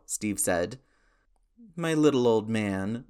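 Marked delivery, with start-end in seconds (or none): 1.8-3.1 lightly sniffly, drawn-in fondness; a touch of mock tearfulness; mock sniffle. A narrator's reading. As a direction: tease with a pretend sniffle. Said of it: "Sam sniffled".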